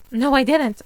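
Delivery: loud, high protest; loud denial. Acted. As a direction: protest hard. Denying to ghost.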